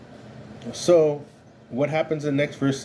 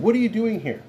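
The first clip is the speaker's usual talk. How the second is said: much higher, pointed demand on WHAT; challenging question; 9 semitones higher than usual. Acted. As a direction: call him out, a rebuke. God questions Elijah sharply.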